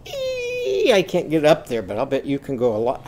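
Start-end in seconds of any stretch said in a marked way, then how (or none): 0.1-0.9 extremely high, thin squeaky "ee"; straining up to a tiny high squeal. Shown as a demonstration. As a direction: squeak "ee" at the very top of your range. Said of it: "high, squeaky voice, try that and go".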